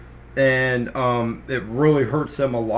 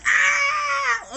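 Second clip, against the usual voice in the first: high mock scream on the way down. Pygmies falling from the plane, high mock scream.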